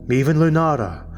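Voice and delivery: plain reading voice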